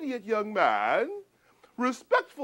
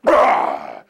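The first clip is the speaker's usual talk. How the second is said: much lower, loud growling roar; monster roar; 11 dB louder than usual. Acted. Storyteller scaring children, deep loud monster roar. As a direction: leap out like a monster. Creature leaps out.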